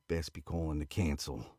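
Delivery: lightly voiced slow Aussie drawl; a hint of a slow Aussie drawl; southern Aussie drawl. Trip answering his friend's late call, mild Aussie drawl. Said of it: "slow southern Aussie drawl".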